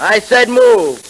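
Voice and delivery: barked order, slow and loud; angry command. Acted. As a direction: force him to move, rising anger. Captor orders prisoner to move.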